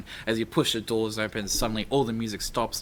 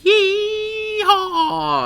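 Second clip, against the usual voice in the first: slow, high, loud Western drawl welcoming guests; hammed-up cowboy accent ending in a whoop; 9 semitones higher, 7 dB louder, much slower than usual. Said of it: "I can't do that accent".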